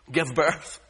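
normal talking voice

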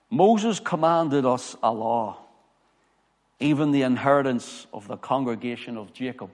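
grave pulpit reading; scripture reading. Acted. Preacher reads scripture aloud.

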